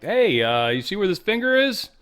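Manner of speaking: hammed-up defiant retort; mock defiant Netanyahu. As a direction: tell them where to shove it, with mock swagger. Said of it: "And he's like".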